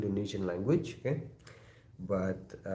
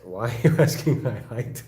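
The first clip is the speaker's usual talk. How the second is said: amused, laughing, louder question; 5 dB louder than usual. Real, not acted. Viewer asks his height, he answers laughing. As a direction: laugh it out, half puzzled.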